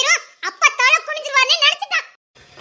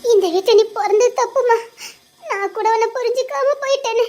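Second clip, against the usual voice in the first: tearful lament; 2 semitones higher than usual. Acted. Mother laments missing daughter.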